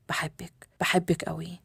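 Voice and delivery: near-whisper, then softly voiced; intimate confession. Man declaring love to the lady of his heart, hushed. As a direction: breathe the love words close and low.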